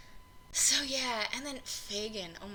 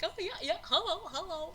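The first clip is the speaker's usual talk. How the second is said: lightly voiced, higher, goofy yes-man greeting; dopey sidekick cat; 9 semitones higher than usual. The cat sidekick blindly going along, light goofy voice. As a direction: play a grinning tag-along who agrees with everything. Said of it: "the cat's just kind of the guy that goes along".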